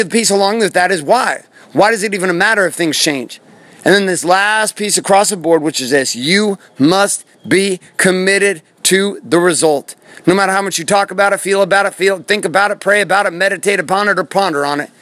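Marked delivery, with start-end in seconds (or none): 6.1-9.9 slow, word-by-word delivery; slow, emphatic. Real, not acted. Speaker drives home the last piece to listeners, slowly and emphatically. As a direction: space out every word for weight. Deliberate emphatic closing point.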